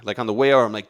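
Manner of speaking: normal talking voice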